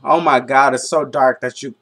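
usual speaking voice

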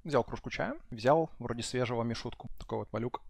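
quiet. Real, not acted. Soft calm talk.

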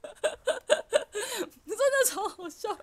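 high, very slow laughter through the words; laughing too hard to talk; wheezing laugh at the memory; real laughter. Real, not acted. Laughing at story.

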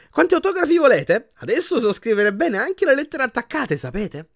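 very high, bubbly offer; exuberant boasting. A narrator's reading. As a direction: brag with childlike pride. Vain patient eagerly offers autographs.

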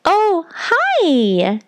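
high, put-on friendly greeting; exaggerated non-threatening sweetness, high-pitched; high careful voice. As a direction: pitch it way up to sound harmless.

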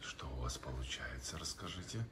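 hushed whisper. Real, not acted. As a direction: whisper so she keeps drawing. Whispering near drawing child.

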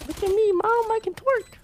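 very high squeaky voice; squeaky girly voice. Pretend. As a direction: squeal it like a little girl.

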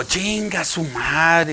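very slow drawn-out curse; dismayed curse. Retold or imitated. Patient reacts slowly in disbelief to the doctor.

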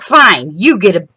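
loud, fed-up mock surrender to the dog; exasperated give-up. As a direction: throw up your hands at the dog. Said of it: "I'm like".

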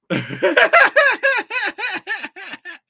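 high, loud cackling laugh; big laugh. Real, not acted. Streamer cracks up at own distraction joke, high cackle.

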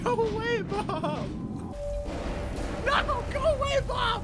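high panicked go away; frightened shooing. Real, not acted. Gamer yells at the monster Bob to go away. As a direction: shoo the monster off in fear.

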